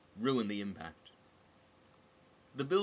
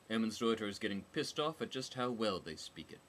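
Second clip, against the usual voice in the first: ordinary delivery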